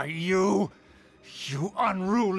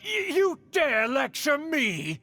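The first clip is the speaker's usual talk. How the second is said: high, stammering indignant shout; hysterical outrage; 7 semitones higher than usual. Acted. Uncle outraged at being lectured by niece, hysterical. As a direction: splutter it, then shout in affront. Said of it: "getting pretty hysterical here".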